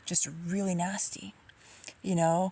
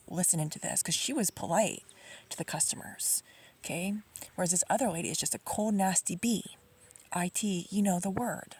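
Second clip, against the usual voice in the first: ordinary talk, nothing marked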